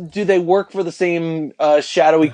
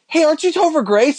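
excited recognition; 11 semitones higher than usual. Retold or imitated. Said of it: "and I'm like".

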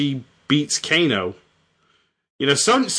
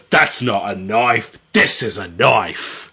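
low, slow Australian drawl; lightly put-on Australian accent; Kano's Australian accent; 6 semitones lower, slower than usual. Kano threatening a fighter in a low Australian accent. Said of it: "Kano says".

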